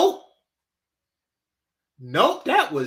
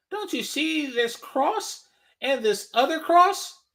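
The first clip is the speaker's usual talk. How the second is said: mocking outraged woman; 5 semitones higher, 4 dB louder than usual. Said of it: "mocking the woman".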